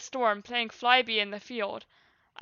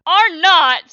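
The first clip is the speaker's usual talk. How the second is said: loud, higher retort; indignant protest; 6 semitones higher, 12 dB louder than usual. A narrator's reading. Meteor and Violet denying it, loud protest. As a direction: protest loudly against the teasing. Teased pair protest in chorus.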